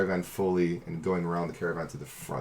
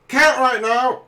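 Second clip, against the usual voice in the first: high, loud shout; harried high-pitched yell; dwarf shouting; 9 dB louder, slower than usual. Acted. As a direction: shout back from the thick of battle.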